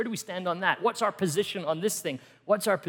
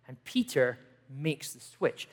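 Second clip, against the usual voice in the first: normal talking voice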